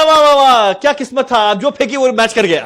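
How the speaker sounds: high, loud exclaiming wonder; mock amazement. Trainee teases the man over lucky match, mock amazement. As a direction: marvel loudly and teasingly at his luck.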